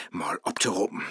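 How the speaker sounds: half-whispered and low; muttering aside. Acted. Faust mumbles to himself while opening his app. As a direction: mumble it to yourself.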